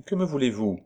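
curt, cool question; cold, almost hard. Acted. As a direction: ask it as a chilly challenge. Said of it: "dont la voix était froide et presque dure".

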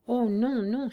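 loud pleading no; desperate cry. A narrator's reading. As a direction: cry out against losing him. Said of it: "s'écria Dieudonné".

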